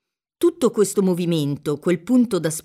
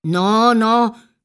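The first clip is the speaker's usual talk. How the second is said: louder, insistent denial; raised-voice priest hastening to reassure; emphatic reassurance; 3 semitones higher, 6 dB louder than usual. A narrator's reading. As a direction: contradict firmly to reassure. Priest reassures the dazed girl.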